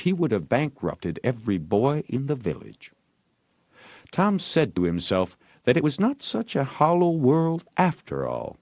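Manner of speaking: plain reading voice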